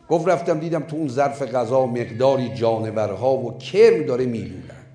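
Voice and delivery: ordinary talk, nothing marked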